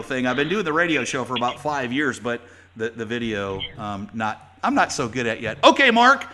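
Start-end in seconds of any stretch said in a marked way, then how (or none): none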